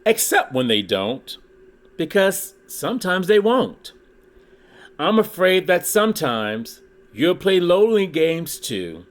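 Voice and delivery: lively read-aloud with rhyming bounce; storybook reading. Acted. Reading a Dr. Seuss book to his YouTube viewers, lively storytelling. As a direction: read it like a children's picture book.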